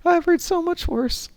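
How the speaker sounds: slow amused aside, rising; wry inner thought. Retold or imitated. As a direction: drawl it, unimpressed. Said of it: "And I'm thinking".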